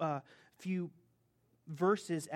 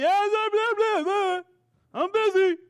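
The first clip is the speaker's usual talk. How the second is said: much higher, louder blah-blah voice; mock brush-off; 14 semitones higher, 11 dB louder than usual. Mocking the employee walking away, a high dismissive brush-off. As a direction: lampoon the worker who can't be bothered.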